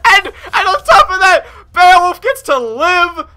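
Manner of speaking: high, loud cry of triumph; real overjoyed shouting; excited shout. Real, not acted. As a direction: yell it out, near tears of joy. Excited gamer cheering a win.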